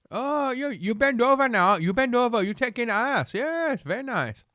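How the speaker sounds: high-pitched mock Chinese accent; terrible Chinese accent. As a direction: put on a bad, hammed-up Chinese accent. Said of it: "That was a terrible Chinese accent".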